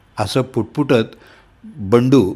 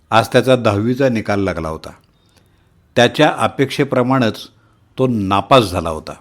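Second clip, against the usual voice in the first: usual speaking voice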